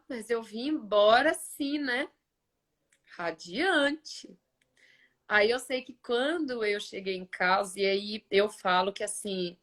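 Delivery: normal talking voice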